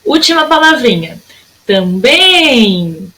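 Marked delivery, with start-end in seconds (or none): 1.6-3.1 "Também" with a long held "bém"; stretched last syllable. Shown as a demonstration. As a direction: draw out the end of "Também". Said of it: "O meu bem foi a sílaba que durou mais".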